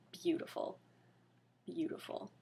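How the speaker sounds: breathy whispered awe, twice. Acted. Reader whispering in mock awe at the fanfic. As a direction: hush it out reverently.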